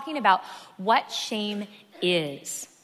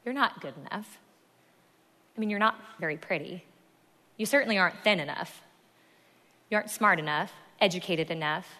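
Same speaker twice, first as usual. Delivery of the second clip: hushed, lowered voice of self-doubt; voice of shame; 4 semitones lower than usual. Acted. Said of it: "the voice of shame sounds like this".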